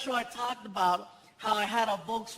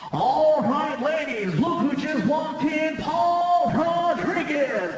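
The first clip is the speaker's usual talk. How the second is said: louder, slow stretched DJ announcement; club DJ hype; 5 semitones higher, 7 dB louder, slower than usual. Retold or imitated. DJ announcing the celebrity to the dancers, loud drawn-out hype. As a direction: boom it out like a club MC. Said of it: "the D J's like".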